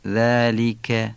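ذلک very slowly, clear "li" and crisp k; Arabic kasra, sharp kaf. Shown as a demonstration. Teacher showing kasra and kaf in ذلک, slow and articulated. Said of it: "کسره عربی".

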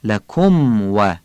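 لکم و very slow, the م fully voiced; clear mim. Shown as a demonstration. Teacher contrasts the correct لکم و, slow.

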